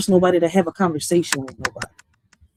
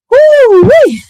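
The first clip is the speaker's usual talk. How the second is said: excited whoop; 11 dB louder than usual. Real, not acted. Bursting out in delight.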